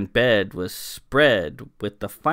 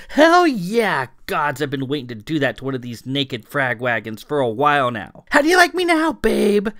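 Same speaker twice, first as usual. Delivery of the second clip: much higher and louder, crowing with glee; ecstatic gloating; 7 semitones higher, 7 dB louder than usual. A narrator's reading. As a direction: gloat over the fallen woman. Clara gloating after hitting Alana.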